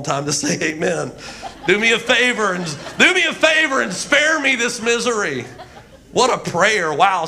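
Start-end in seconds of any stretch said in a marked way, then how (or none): 1.7-5.4 high, hammed-up preacher plea; Pentecostal preacher. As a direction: beg God in revival cadence. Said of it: "like a Pentecostal preacher".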